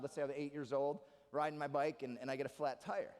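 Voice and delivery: normal talking voice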